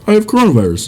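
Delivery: high-pitched mimicked announcement; imitating a friend on the phone. Friend's repeat voiced.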